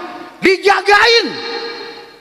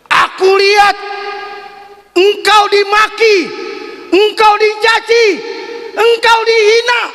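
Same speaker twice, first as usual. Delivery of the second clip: indignant building cry; 8 semitones higher than usual. Acted. Angel protesting Prophet's insult.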